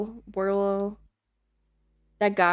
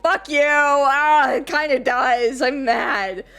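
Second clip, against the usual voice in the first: higher, louder hammed-up fake anger, laughing at the end; mock outrage; 6 semitones higher, 6 dB louder, slower than usual. Retelling snapping at friends, hammed-up mock anger. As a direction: playfully snap back, then cave in. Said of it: "so pissed off".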